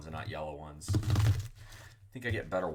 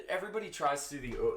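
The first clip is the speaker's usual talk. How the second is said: ordinary delivery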